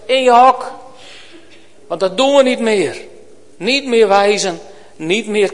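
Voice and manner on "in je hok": a loud, high telling-off, as to a dog; stern command. Pretend. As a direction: order the finger back to its kennel. Scolding the pointing finger like a dog.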